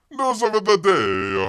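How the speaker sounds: loud, slow news announcement; announcer. Acted. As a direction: boom it like a headline.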